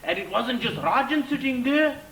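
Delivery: ordinary talk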